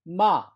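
마 starting high, falling sharply, loud; fourth tone. Shown as a demonstration. Teacher models tone four for students, loud falling 마. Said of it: "사 성은".

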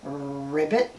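low, quiet ribbit; soft low frog call; frog croak. Pretend. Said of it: "he goes".

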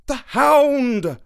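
high, loud horrified shout; drawn-out, high-pitched cry of alarm. A narrator's reading. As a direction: cry it out in sudden recognition. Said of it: "cried Holmes".

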